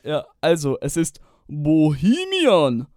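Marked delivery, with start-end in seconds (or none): 1.5-2.9 very slow, louder; slow triumphant guess; drawn-out answer. Real, not acted. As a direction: stretch out the answer. Guesses song title.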